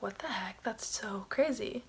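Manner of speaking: barely audible, drawn-out whisper of disbelief; stunned whisper. Retold or imitated. Woman finds the phrase is another language, stunned whisper. Acting out shock at Sanskrit discovery.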